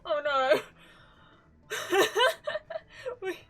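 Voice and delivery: ordinary talk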